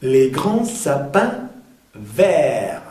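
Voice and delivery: "Les grands sapins verts" slow, word by word; nasal endings on "grands" and "sapins"; careful model of "Les grands sapins verts", final letters silent; pronunciation demo. Shown as a demonstration. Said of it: "Sapin es el sonido un".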